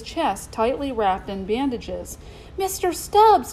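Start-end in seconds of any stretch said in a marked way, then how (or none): 2.6-3.5 high, loud joyful exclamation; crying out. A narrator's reading. Boy sees his chimp alive, crying out in joy. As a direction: cry out at a friend found alive. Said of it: "Toby cried".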